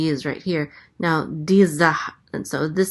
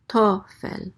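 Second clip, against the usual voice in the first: tofel with a higher-pitched opening syllable; stress on first syllable; 4 semitones higher than usual. Shown as a demonstration. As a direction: lift the to in tofel, let fel fall. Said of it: "the accent is on the first syllable".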